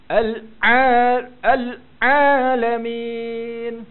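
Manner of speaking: a hammed-up "Al-Alamin" pulled far too long; overdone Ain. Shown as a demonstration. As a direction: overdo the Ain, dragging it out and pressing hard. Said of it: "jangan nak tarik sangat".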